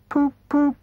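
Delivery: high, far-off dreamy poop poop; dreamy murmur. Acted. Toad murmuring after the motor car, dazed. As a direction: echo the motor horn in a trance. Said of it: "still heard to murmur".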